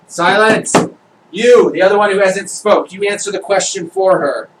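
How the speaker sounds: loud, high commanding judge; angry judge. Acted. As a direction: shout them down from the bench.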